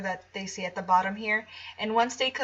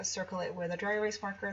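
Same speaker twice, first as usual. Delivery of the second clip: ordinary delivery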